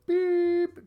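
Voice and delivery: very high, held beep sound; imitated high-pitched censor tone. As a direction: bleep out the name yourself. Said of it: "I'm saying Beep".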